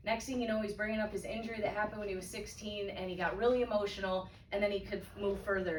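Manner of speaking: ordinary delivery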